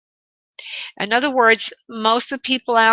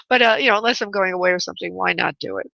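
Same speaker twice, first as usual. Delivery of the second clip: ordinary delivery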